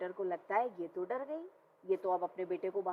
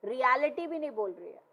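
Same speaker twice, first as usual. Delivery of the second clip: ordinary talk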